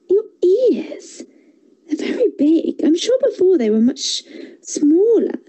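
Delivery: put-on a curious girl noticing something odd; little girl voice. Girl's voice in story.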